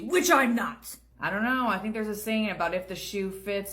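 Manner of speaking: usual speaking voice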